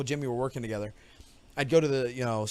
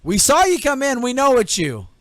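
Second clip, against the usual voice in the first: loud, higher threatening shout; cop yelling; 7 semitones higher, 7 dB louder than usual. Retold or imitated. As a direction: warn him through the door, loud. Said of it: "He goes".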